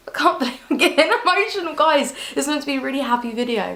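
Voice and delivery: high, choked-up voice with a laugh; tearful. Real, not acted. New mum apologising to viewers for getting emotional, tearful. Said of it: "I'm getting really emotional".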